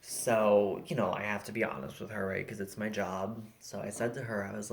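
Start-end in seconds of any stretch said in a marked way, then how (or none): none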